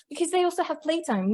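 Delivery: ordinary talk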